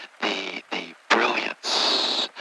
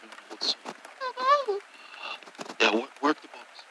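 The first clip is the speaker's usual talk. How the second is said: far off from the mic; 3 semitones higher, 10 dB quieter than usual. Acted. Distracted, talking away from phone.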